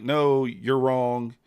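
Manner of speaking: loud, drawn-out mimicked contradiction; mock flat denial. Trader quoting the company's first reply, loud mock denial. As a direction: imitate them refusing, slow and emphatic. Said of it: "they at first said, like".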